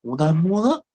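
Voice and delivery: mock surprise. Patient questions bringing clothes.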